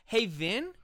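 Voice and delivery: ordinary delivery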